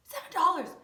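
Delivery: high, loud indignant cry; outraged exclamation. Real, not acted. Complaining about the seven dollar Band-Aid, indignant. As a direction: exclaim at the ridiculous price.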